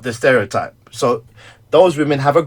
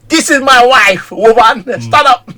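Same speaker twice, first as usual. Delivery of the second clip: louder, higher and slow from the pulpit; loud announcement; 10 semitones higher, 8 dB louder than usual. Retold or imitated. Preacher shows off his wife to the church, loudly. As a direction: proudly boom it out to the church. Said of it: "I love doing that to her".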